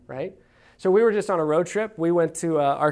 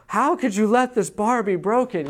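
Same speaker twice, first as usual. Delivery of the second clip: mock-devastated kid, pitched up; crushed child; 6 semitones higher, 3 dB louder than usual. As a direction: imitate a heartbroken four-year-old's protest, lightly. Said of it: "he's just crushed".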